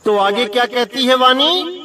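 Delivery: ordinary delivery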